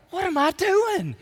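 high, acted-out disbelief; mock astonishment at your own behavior; amazed at himself. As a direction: ask it in giddy bewilderment. Acting out his amazed self mid-celebration.

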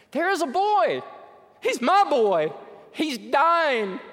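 loud, high emphatic declaration; impassioned mother. Acted. Preacher voices a mom defending her dying boy's touchdown, impassioned. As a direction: cry it out in defence of your son.

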